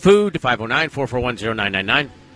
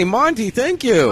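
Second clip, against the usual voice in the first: calling out; 9 semitones higher, slower than usual. Real, not acted. Calling out thanks.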